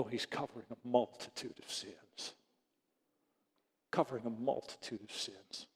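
very soft, low, humbled voice; hushed reverence. Real, not acted. Pastor telling his church of God's forgiving love, hushed. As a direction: drop right down, quietly in awe.

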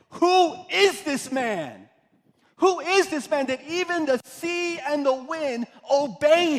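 loud, high, drawn-out astonishment; awestruck amazement. Acted. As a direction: exclaim in wonder as stunned disciples. Disciples amazed after storm is calmed.

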